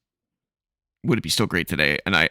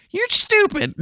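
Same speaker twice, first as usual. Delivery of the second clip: much higher, laughing mock outburst; retold kid's scorn; slower than usual. Host voices childhood self judging adults, mock high-pitched scorn. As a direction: squeal it as an exasperated kid, half laughing.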